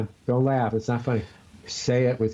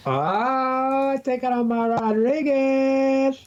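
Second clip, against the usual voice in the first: mock Spanish voice; 12 semitones higher, much slower than usual.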